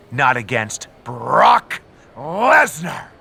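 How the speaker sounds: each word dragged out and leaned on; gloating slow warning; slow taunt. Acted. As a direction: spell out the threat slowly. Miz taunts with Brock threat.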